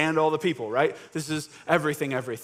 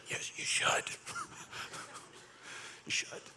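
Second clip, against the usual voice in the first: whisper; 14 dB quieter than usual. Real, not acted. Aside to Christians, laughing.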